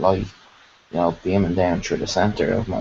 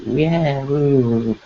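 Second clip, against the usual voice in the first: high, louder happy yelp of yeah woo; excited whoop; 7 semitones higher, 3 dB louder than usual. Retold or imitated. Retelling meeting the elves, an excited whoop. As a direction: whoop it up like a kid let loose. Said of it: "I was, like, real excited".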